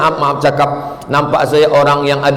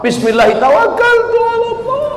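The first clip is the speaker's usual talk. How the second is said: high, lilting pilgrim's prayer voice, pitched far up; a teary pilgrim voiced in full; mock tearful prayer. Pilgrims' departure prayer imitated.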